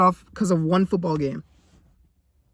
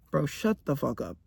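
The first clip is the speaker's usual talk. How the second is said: low, softer annoyed mutter; muttered shut up; 3 semitones lower, 8 dB quieter than usual. Acted. Annoyed vlogger to an imagined commenter, low muttered. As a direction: grumble it under your breath. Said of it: "'cause I know somebody's thinking that right now".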